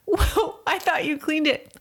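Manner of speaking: exasperated. Retold or imitated. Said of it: "I'm like".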